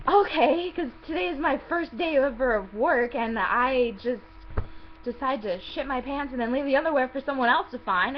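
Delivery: loud mock-agreeing sarcasm; hammed-up indignant sarcasm, emphatic opening; sarcastic outrage. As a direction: play along with an absurd accusation, incredulous. Sarcastic answer to the accusation.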